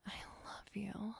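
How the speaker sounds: soft unvoiced "I love you" breathed close; whispered I love you. Repeated love declaration.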